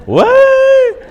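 high, loud startled "what?"; shocked cry. Real, not acted. Shocked reaction to crude joke.

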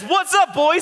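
put-on high-pitched holler; calling out playfully. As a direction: sing out a hello to pals on shore. Jesus calls to fishermen from shore.

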